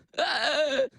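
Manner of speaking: loud breathless gasping laugh; imitated starstruck gasping laugh. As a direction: mimic a friend too starstruck to breathe. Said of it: "my friend starts going".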